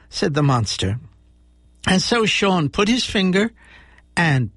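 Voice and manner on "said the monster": ordinary delivery, nothing marked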